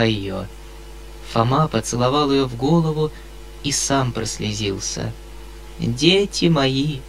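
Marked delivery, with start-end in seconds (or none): 5.8-7.0 slow, moved, solemn blessing; tearful blessing. Acted. Foma blessing the children, moved and slow. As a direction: bless them as if weeping.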